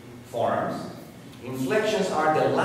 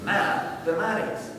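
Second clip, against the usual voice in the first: "math, mathematics" with the opening syllable picked out; single out the MATH that starts "mathematics"; stress on first syllable; 6 semitones higher than usual. Shown as a demonstration. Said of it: "you pick the first syllable".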